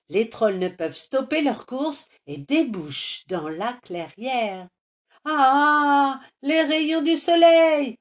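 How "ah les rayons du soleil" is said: very high, loud scream of terror; horrified scream. A narrator's reading. Trolls caught by sunlight, screaming in terror. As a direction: shriek as the sun hits. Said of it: "hurlent en chœur les monstres".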